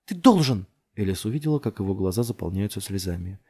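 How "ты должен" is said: high, loud demand; insistent shout. A narrator's reading. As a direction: override his hesitation forcefully. Insisting to dazed man. (настойчиво, на крике)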